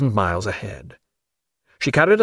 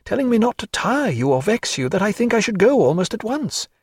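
higher, lighter lady's voice, fond and fluttering; Diana's voice; 9 semitones higher than usual. Acted. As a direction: play a lively society lady fussing over a sick friend.

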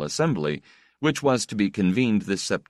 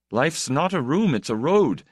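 hollow pronouncement, lilting up on "room"; mock-wise, pitched up, falling on "road"; fatuous, fraudulent; 9 semitones higher, 4 dB louder than usual. A narrator's reading. Man replaying his own words to himself, fatuous and fraudulent. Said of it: "saying fatuously, fraudulently".

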